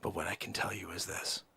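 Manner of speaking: secretive whispered sales pitch; hushed whisper. Acted. Salesman whispering a secret tip to a client on the phone, hushed whisper. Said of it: "I don't want anybody here on the floor to know".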